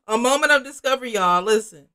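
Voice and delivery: loud, high, quick announcement; mock revelation. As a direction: burst out with a big dramatic discovery. Acted repeat announcing a revelation.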